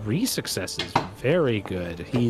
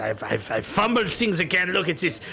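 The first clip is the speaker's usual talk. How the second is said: put-on lightly accented gambler, sheepish and then pushy; apologetic loser wanting his money back; Bretonnian accent. Gambler begs the players for one more game, Bretonnian accent.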